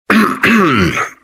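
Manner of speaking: short, rough clearing of the throat. Acted. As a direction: clear your throat before speaking. Clears throat before asking.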